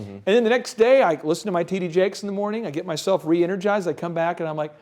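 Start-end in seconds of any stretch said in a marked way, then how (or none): none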